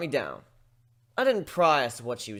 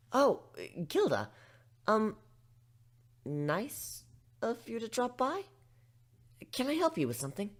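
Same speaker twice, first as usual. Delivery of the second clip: higher, flustered librarian voice; startled pony; 8 semitones higher than usual. Acted. Twilight startled by Gilda visiting the library, flustered. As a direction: greet her politely but startled.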